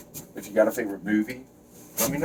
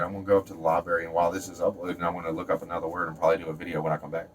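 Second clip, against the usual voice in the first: rapid, low rattled-off patter; fast talking; 3 semitones lower, 4 dB quieter, much faster than usual. Real, not acted. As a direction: race through the plan without stopping. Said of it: "the reason I talk like this sometimes".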